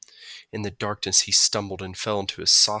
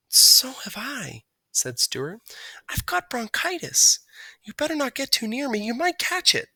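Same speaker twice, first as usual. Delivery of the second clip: a poorly mouse warning his friend; sick little mouse; 13 semitones higher than usual. A narrator's reading. Stuart warning Margalo about his bronchitis.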